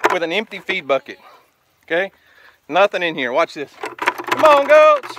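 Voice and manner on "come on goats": loud, high herding call; calling livestock. Real, not acted. Farmer summoning goats with an empty bucket, calling loudly. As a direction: holler for the herd to come.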